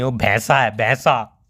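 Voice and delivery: भैंसा said low and heavy, pressed down on the word; stress on buffalo. Real, not acted. Boyfriend insulting the brother on the phone, low and heavy on भैंसा. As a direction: land hard on भैंसा, low and scornful. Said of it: "वो भैया नहीं है".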